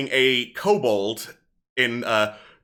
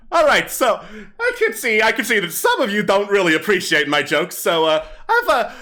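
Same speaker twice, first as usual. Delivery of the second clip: higher, livelier stage patter; kobold comedian; 10 semitones higher, 5 dB louder, faster than usual. Acted. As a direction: work the crowd like a hammy stand-up comic. Kobold tells joke.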